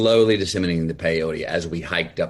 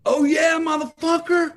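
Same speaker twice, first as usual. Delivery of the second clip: much higher, much louder furious yell at the cabbie; retold angry shout; 9 dB louder than usual. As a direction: blast back at him, fed up and mocking. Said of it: "Pat, just goes".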